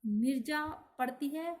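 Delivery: single out Nirja with extra force; stress on Nirja. Shown as a demonstration. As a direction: lean hard on Nirja. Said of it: "Nirja शब्द पर बल दिया जा रहा है".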